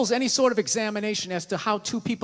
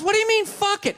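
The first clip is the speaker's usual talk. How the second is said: mock girlfriend; 8 semitones higher than usual. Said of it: "She goes".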